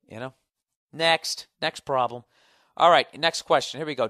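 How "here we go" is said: normal talking voice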